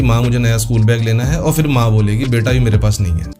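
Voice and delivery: usual speaking voice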